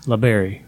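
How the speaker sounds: LaBerry said as luh-BERRY; LaBerry, weight on BERRY; stress on the A. Shown as a demonstration. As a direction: demonstrate LaBerry with a short "luh" first. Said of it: "the A".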